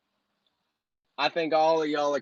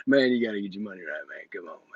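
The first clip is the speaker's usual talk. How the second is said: lower, fast and soft guru voice; Grant impression again; 7 semitones lower, 5 dB quieter, faster than usual. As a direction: drop low and rattle off the line. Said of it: "that was my Grant impression".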